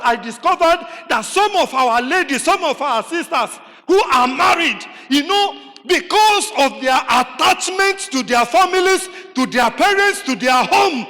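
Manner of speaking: full-voiced pulpit emphasis; preaching. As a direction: press the point home to the congregation. Preacher raising voice on point.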